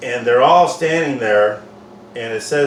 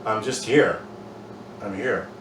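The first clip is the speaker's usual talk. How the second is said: gentle reassurance; 3 semitones lower, 6 dB quieter than usual. Pretend.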